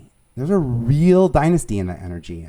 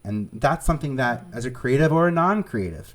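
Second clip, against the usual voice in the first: ordinary delivery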